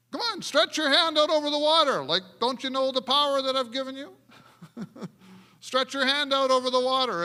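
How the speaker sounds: high, loud impatient command; playful impatient God; God's exasperated voice. Retold or imitated. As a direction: push Moses impatiently. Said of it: "and God says".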